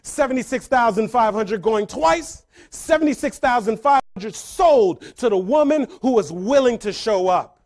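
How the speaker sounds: loud auctioneer closing the sale. Retold or imitated. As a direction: call it out, drawing out the last words. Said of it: "The auctioneer".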